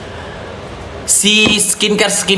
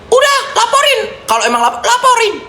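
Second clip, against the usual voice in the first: mocking taunt. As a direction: taunt them in a squeaky high voice. Taunting critics to report her.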